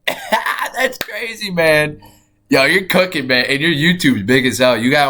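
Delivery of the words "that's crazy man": high, slow laughing words; amazed laughter. Real, not acted. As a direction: laugh through it in delighted disbelief. Laughs at friend's sudden growth.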